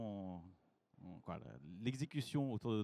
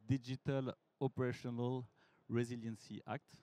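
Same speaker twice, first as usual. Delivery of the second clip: careful French-tinged English accent. Shown as a demonstration. Director presenting DORA to the audience, showing off his English accent. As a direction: say the name slowly in your best English accent. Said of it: "avec mon bel accent anglais".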